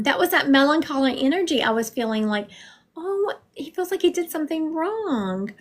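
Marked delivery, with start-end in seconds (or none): none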